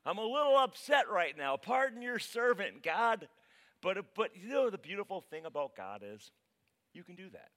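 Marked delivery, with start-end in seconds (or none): 0.0-3.3 loud, high-pitched, agitated plea; acted upset protest; upset Joshua. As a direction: blurt it out at God, still worked up. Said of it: "That's Joshua".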